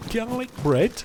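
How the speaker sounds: Garlic bread? jumping high in delight; surprised. Shown as a demonstration. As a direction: echo "Garlic bread?" with a high rising squeal.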